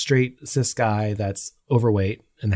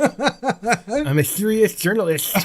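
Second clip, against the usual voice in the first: high, slow words through laughter; laughing hard; 12 semitones higher, 3 dB louder, much slower than usual. Real, not acted. Podcaster joking about being serious, laughing hard. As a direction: barely get it out while cracking up. Hosts cracking up at own joke.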